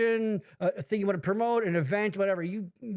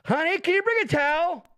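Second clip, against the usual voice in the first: higher, raised-voice call to a partner; 9 semitones higher, 4 dB louder than usual. Pretend. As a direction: holler for help from another room. Calling to partner as a gag.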